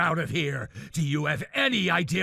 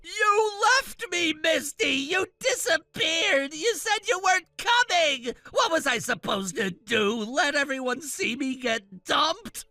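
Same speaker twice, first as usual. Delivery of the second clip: tearful accusation. Acted. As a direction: hurl the blame, close to crying. Groom blames her, crying out.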